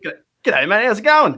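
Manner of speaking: Aussie greeting, lifted on the last phrase; broad Australian accent. Shown as a demonstration. Australian showing an American how to say it, broad accent. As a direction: model a casual Australian g'day. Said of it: "You say that".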